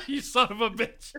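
slow, high, laughing insult; laughing mock outrage. Real, not acted. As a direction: curse him while cracking up.

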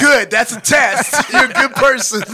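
loud, high, jubilant praise; mock approval. Real, not acted. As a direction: congratulate him loudly, with a laugh. Jokingly approving friend's denial.

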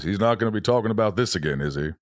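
deadpan the line like Morgan Freeman; Morgan Freeman impression. Morgan Freeman teasing about Michael Caine's rapping. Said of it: "chimes and goes".

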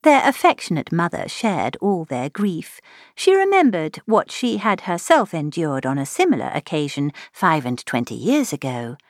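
ordinary delivery

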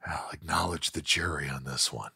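low, soft, offhand tough-guy murmur; understated star-director impression; Clint Eastwood. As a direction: say it quietly and low, no fuss. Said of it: "listen to you doing Clint all day long".

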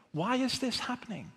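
high-pitched bewildered plea; troubled pleading. Acted. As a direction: question God, pitch lifted well up. Acting a troubled believer questioning God.

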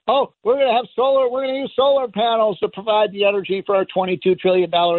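high sing-along mock optimism; mocking imitation. Mocking solar advocates.

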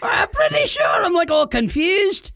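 squealing through laughter; high laughing shout. Real, not acted. Laughing, mock seizure.

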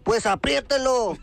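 high, stretched-out put-on voice; campy joke reply. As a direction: deliver the punchline in a slow, campy squeal. DJ responde coqueto en el chiste. (respuesta aguda y amanerada)

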